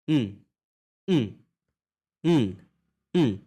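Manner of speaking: "उम" hummed with lips closed, mouth not opening. Shown as a demonstration. Teacher modelling "उम" as a lips-closed hum. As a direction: hum "उम" through shut lips. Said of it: "उसको touch करना है".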